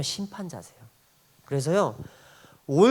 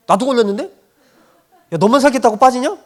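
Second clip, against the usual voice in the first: loud, higher mock-scolding protest; raised voice, comic indignation; indignant retort; 6 semitones higher, 9 dB louder than usual. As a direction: playfully accuse him of bailing out. Acting his retort to his son.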